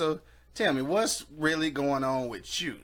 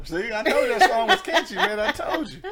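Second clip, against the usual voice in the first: laughing; 7 semitones higher than usual. Real, not acted. Laughing gloat about song.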